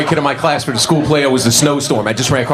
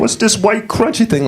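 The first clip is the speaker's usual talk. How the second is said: high-pitched put-on girl's question; puzzled eater; 10 semitones higher than usual. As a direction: ask in a high voice while tearing into food. LaWanda tearing up her food.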